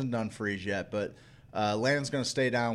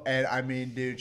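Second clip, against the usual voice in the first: usual speaking voice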